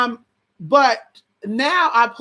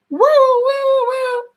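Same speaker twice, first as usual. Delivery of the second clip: high sustained siren wail; police siren imitation; 3 dB louder, much slower than usual. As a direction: wail like a cop car siren.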